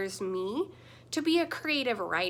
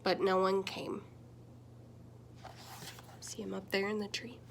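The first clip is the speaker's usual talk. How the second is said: storybook reading. A narrator's reading. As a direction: read it like bedtime story time with little ones. Reads picture book aloud.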